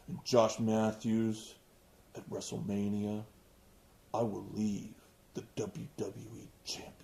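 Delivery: low, soft, flat sleepy imitation; mocking monotone. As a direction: drone it like a bored wrestler. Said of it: "he talks in a monotone".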